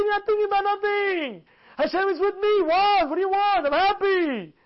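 high, driving sermon delivery; impassioned preaching. Acted. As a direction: preach it insistently, lifting each rhetorical question. Preaches at full force.

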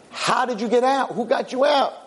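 high-pitched insistent questioning; incredulous demand. Acted. Lawyer questioning his freed friend, incredulous. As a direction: press for an answer in high disbelief.

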